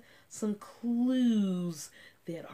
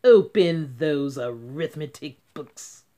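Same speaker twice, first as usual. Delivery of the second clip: loud, lower strict command; stern order; 4 semitones lower, 9 dB louder than usual. Acted. Strict teacher ordering the class to work. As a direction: bark the order.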